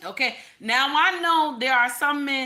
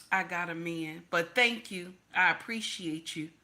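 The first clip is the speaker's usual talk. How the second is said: softer, lower, courteous refusal; polite turn-down; 5 semitones lower, 5 dB quieter than usual. Acted. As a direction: let an admirer down gently. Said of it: "You don't even have to be nasty".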